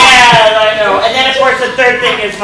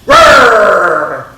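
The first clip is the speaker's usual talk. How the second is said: grumpy growl; 7 dB louder than usual. Acted. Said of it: "says Bruce".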